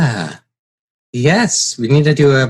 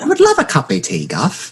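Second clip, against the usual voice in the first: much higher, hammed-up British; British accent; 5 semitones higher than usual. Mimicking the British accent back to the friend. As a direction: play a posh English host.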